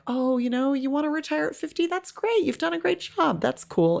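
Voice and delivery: high, fast, gushing fake praise; sugary sarcastic congratulations; mock cheerful. Mock praise from Hollywood people.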